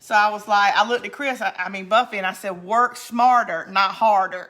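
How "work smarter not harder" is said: loud, slow mock lecture; emphatic retold quip. Woman retelling her advice in the yard argument, loud and emphatic. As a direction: retell it loudly, drawing out the words.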